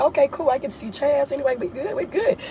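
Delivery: high, giddy and pleased with herself; a giddy teen hiding her glee; excited. Retold or imitated. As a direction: squeal it out, thrilled at the chance to see her crush. Her own excited reply retold.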